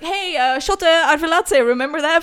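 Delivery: high, loud, hopeful pitch; hammed-up eager boyfriend, breaking into a laugh; mock eager appeal. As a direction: bring up the star player like a winning card. Boyfriend trying the trick again, acted.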